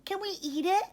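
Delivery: put-on high, eager young voice; squeaky little lamb. A narrator's reading. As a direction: ask it like a hungry little one. Ollie still voiced.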